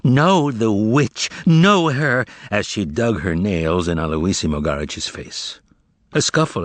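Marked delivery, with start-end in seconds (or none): 0.0-2.2 high, louder, drawn-out howl of fury. Acted. Woman attacking the informer, howling. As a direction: howl it as you claw at him. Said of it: "Margarita howling".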